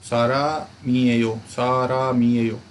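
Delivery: सारा मीएयो drawn out slowly; slow careful reading. Shown as a demonstration. Teacher repeats the phrase slowly for students. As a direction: spell it out slowly for learners. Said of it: "इसी तरह पढ़ेंगे".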